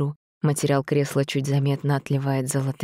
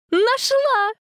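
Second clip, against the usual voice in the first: high squeal of delight; bubbly sorority girl; 4 dB louder than usual. Acted. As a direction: squeal it with joy. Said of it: "завизжала от восторга Даша".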